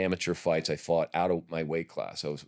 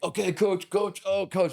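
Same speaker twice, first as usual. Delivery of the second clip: put-on kid's voice; 14 semitones higher than usual. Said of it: "And the kid's like".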